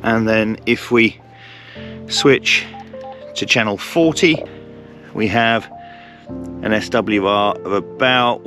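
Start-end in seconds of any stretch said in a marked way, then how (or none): none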